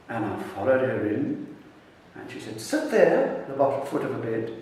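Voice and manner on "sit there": high-pitched imitation of a bossy child; little girl's command. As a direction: give the order as a small girl, pitched well up. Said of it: "this little girl".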